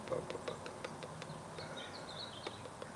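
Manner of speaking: soft unvoiced ba ba ba; barely audible babbling; mumbled filler. Real, not acted. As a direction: murmur nonsense syllables while thinking. Filler sounds while thinking.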